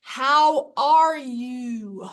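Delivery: very slow, loud, over-articulated; exaggerated lip movements. Shown as a demonstration. Speaker demonstrating what not to do to lip readers, exaggerated mouthing. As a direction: stretch and over-mouth every word. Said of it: "don't exaggerate your lip, lip movements".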